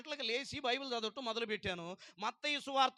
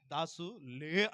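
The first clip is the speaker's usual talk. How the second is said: voice of Jesus; 7 semitones lower, much slower than usual. Retold or imitated. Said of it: "అన్నాడు".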